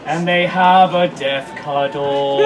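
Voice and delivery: very slow, sinking to a low drawl; ultra slow motion. A narrator's reading. Storyteller closing the slow-motion death, ultra slow. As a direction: drag it out like slowed tape. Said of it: "in ultra slow motion".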